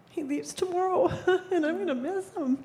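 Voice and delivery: whispered through tears; tearful whisper. Real, not acted. Telling the circle her student leaves tomorrow, tearful whisper. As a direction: choke it out in a breathy whisper, nearly crying. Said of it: "I don't know why I'm bawling".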